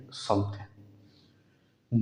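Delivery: समथिंग said slowly as samthen, no ng; correct -in ending. Shown as a demonstration. Teacher models समथिंग for students, slow and soft. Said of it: "समथिंग नहीं है".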